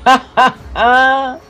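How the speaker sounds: ha ha ha that won't stop; helpless laughter. Acted. Big wheel to Dorothea, can't stop laughing. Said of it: "will you stop laughing".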